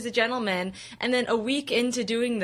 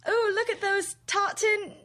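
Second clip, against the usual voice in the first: high-pitched hammed-up British accent; 9 semitones higher, slower than usual. Shown as a demonstration. Performer to the people walking by, hammed-up British accent. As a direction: call it out in a put-on British accent. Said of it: "this is what I would always say to the people".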